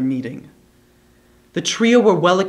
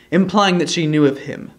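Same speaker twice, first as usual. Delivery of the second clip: usual speaking voice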